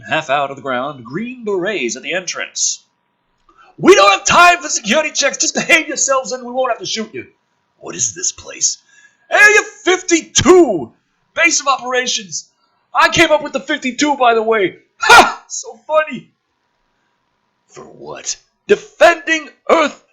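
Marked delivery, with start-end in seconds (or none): 3.8-7.3 high, loud brash military man; gruff officer voice. Acted. Woodhurst voiced in script. 9.3-16.3 high, loud brash military man; gruff officer voice. Acted. Woodhurst voiced in script.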